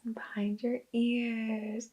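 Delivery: slow, hushed imitation of a meditation video; soft, drawn-out soothing instructions; sweet soothing guide. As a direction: gently guide them, almost whispering. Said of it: "it was so sweet".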